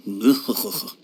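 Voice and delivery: low, drawn-out noise of distaste; disgusted groan. Acted. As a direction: groan at the thought of eating greens. Disgust at eating green vegetables.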